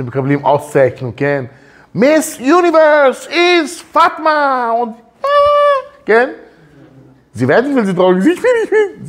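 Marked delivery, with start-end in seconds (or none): 1.9-6.3 much higher, slow, squealing winner's voice; mock beauty queen. Imitates tearful beauty queen winner. 7.3-9.1 laughing. Real, not acted. Laughing while explaining.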